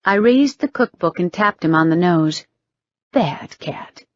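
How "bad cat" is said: whispered, teasing. Acted. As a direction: breathe it out mockingly.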